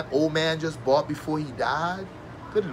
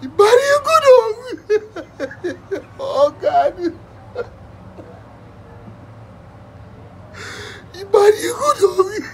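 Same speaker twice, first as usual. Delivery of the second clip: high, slow, loud sob-shout of a mourner; mock grieving wail; 7 dB louder, slower than usual. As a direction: wail over the body like a hammed-up funeral mourner. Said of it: "I'm gonna cry with you".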